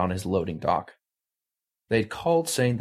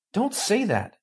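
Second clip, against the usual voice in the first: high, scared blurt; frightened plea; 12 semitones higher than usual. Acted. Callie stopping Ravi from calling the cops, scared. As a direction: cut him off in alarm. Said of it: "her voice small".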